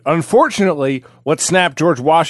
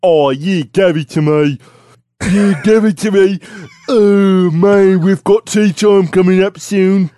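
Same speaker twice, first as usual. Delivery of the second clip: put-on hammy British accent, higher and louder; 4 semitones higher, 3 dB louder than usual. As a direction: overdo a posh British accent. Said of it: "Is that how British people talk?".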